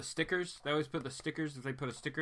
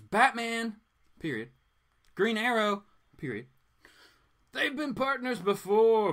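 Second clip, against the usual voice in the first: booming trailer-narrator voice, louder; dramatic blurb read; 4 semitones higher, 4 dB louder than usual. Acted. Comic vlogger reads the back cover as a dramatic trailer.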